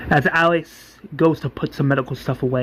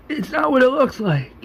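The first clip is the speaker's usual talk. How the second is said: high-pitched pleading in mock panic; acted, much higher defensive protest; panicked excuse; 11 semitones higher than usual. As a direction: plead like someone caught out. Acted caught man pleading.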